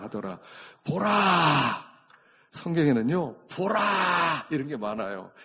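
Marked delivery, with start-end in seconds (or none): 0.8-1.9 loud, long-drawn proclamation; exclaimed wonder. Shown as a demonstration. Preacher demonstrates the Bible's word Behold, exclaimed wonder. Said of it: "원더풀! 이런 뜻이에요". 3.5-4.5 loud, long-drawn proclamation; exclaimed wonder. Shown as a demonstration. Preacher demonstrates the Bible's word Behold, exclaimed wonder. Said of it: "원더풀! 이런 뜻이에요".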